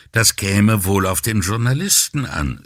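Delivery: ordinary delivery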